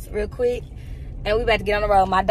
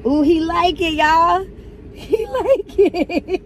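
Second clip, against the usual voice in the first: delighted; 6 semitones higher, 4 dB louder, much slower than usual. Real, not acted. Dog loves whipped cream.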